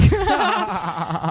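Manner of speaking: high laugh. Real, not acted. Loud laugh.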